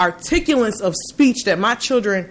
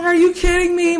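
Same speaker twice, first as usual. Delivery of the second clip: incredulous; 10 semitones higher than usual. Retold or imitated. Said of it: "I'm like".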